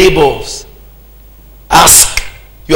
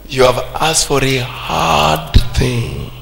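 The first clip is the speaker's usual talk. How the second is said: slow, softer, weighty answer; grave reply; 3 semitones lower, 6 dB quieter, slower than usual. Retold or imitated. Preacher voicing the man of God answering Elisha, slow and grave. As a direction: draw it out softly, weighing the request.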